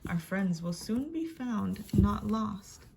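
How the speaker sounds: ordinary delivery, nothing marked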